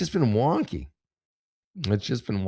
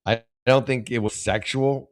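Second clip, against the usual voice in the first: ordinary talk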